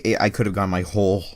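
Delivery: ordinary delivery, nothing marked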